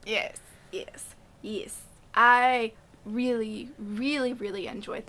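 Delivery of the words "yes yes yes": whispering. Real, not acted. Agreeing in a hushed voice.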